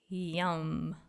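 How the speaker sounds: plain reading voice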